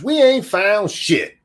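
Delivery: mock street slang delivery; street talk impression. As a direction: drawl it out as a put-on tough guy. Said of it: "in very street language".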